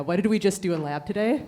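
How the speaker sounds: loud, rising question thrown out to the room; calling out loudly. Real, not acted. Professor calls out to her students in the audience, loudly. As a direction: call out to your students across the hall.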